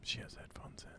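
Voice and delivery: hushed unvoiced whisper. Real, not acted. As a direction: whisper so she won't hear. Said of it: "Why are we still whispering?".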